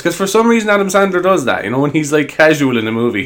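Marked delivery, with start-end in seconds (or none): none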